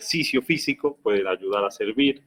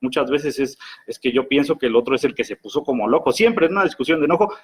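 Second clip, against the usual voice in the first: ordinary delivery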